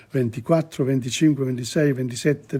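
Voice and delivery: normal talking voice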